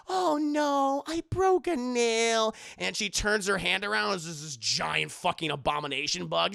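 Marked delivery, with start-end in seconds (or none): 0.1-2.5 higher, louder, slow whining; high whine. Acted. Willie whining about her broken nail. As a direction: whine like a pampered diva.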